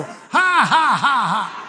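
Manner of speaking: big high forced laugh, falling; deliberate laugh. Shown as a demonstration. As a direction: demonstrate a booming preacher's laugh. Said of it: "laugh some more".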